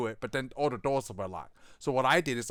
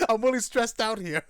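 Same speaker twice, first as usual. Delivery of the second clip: mock stressed chief; 8 semitones higher, 4 dB louder than usual. Imitating the chief.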